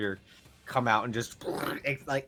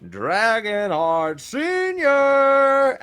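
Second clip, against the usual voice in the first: building arena introduction, rising higher and louder; ring announcer; 9 semitones higher, 8 dB louder, much slower than usual. Acted. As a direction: draw out the final name like a wrestling announcer. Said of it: "Spotlight on the own entrance".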